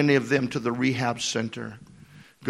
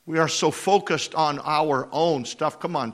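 ordinary delivery

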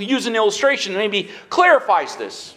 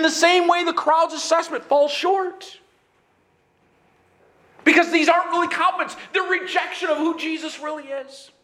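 preaching shout; 8 semitones higher than usual. Real, not acted. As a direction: drive it home with force. Preacher drives home point.

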